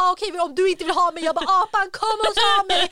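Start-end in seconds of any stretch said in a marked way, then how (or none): 0.0-1.2 higher, louder and fast, huffy; childish defiant threat; sulky angry kid. Retold or imitated. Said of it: "jag blir så arg på henne". 1.6-2.8 high and loud call to the monkey; defiant kid yelling into the yard. Retold or imitated. Child calls to the monkey.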